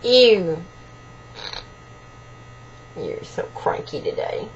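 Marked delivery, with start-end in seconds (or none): 3.0-4.5 breathy whisper to the bird; hushed teasing whisper; soft whisper. Real, not acted. Owner murmuring to her parrot.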